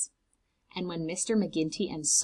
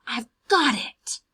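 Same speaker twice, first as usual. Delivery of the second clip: triumphant exclaim, a bit louder; eureka; 5 semitones higher, 6 dB louder than usual. Acted. Mister McGinty telling Sophie his idea, triumphant.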